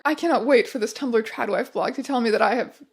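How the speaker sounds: normal talking voice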